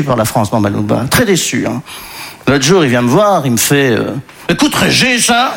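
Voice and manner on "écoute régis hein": put-on Belgian accent. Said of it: "Il vient du Congo belge".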